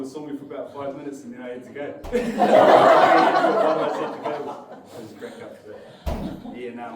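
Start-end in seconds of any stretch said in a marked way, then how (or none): 2.1-4.7 slow, loud, laughing through every word. Real, not acted. Repeating the punchline to friends, laughing loudly. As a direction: collapse into laughter on it.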